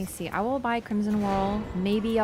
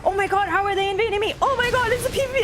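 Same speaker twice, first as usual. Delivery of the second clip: rising alarm, voice climbing higher; mock freaking out; 9 semitones higher than usual. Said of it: "freaking out".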